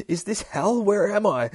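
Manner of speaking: high, alarmed disbelief; panicked questions. Acted. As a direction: fire off the questions in acted panic. Acts comic victim's panicked questions.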